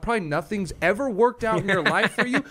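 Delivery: high, rising retold yell; yelling at heckler. Comedian retelling telling off a drunk heckler, high yell. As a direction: tear into him, voice climbing.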